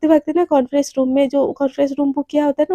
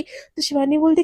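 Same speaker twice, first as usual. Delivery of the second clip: ordinary delivery, nothing marked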